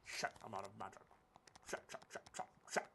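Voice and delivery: rapid whispered swearing; frantic whispered shits; whispered cursing. Real, not acted. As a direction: hiss the curses in a panic. Out of magic, panicking.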